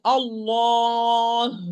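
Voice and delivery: "Allah" with a long held vowel released into h; slow demo of "Allah" ending on h; audible final ha. Shown as a demonstration. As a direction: stretch "Allah" and breathe out the last h. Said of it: "Like Allah".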